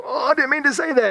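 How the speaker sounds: high, quick backpedal; alarmed regret. Acted. Someone regretting mean words they just said, alarmed backpedal.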